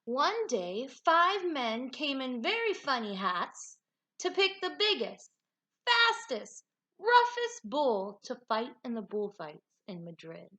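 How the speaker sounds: lively, expressive storybook reading; animated read-aloud with big swoops in pitch; reading to children. A narrator's reading. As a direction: read it like a picture book for a class. Teacher reads picture book aloud.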